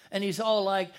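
normal talking voice

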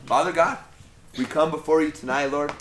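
heartfelt led prayer. Acted. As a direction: pray aloud over the listeners. Leads closing prayer.

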